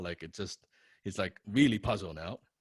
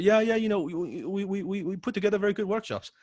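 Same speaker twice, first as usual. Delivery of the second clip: higher, lightly voiced businessman; imitating Alan; 10 semitones higher than usual. Writer misunderstands the fan, lightly voiced proud reply. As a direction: mimic a pleased host plugging his workshops. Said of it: "He said".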